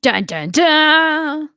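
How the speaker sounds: slow, drawn-out ominous sting; dramatic dun dun dun. Acted.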